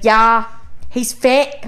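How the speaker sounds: put-on British dating-show voice; mock British accent. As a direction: agree like a British islander. Acting British Love Island contestants.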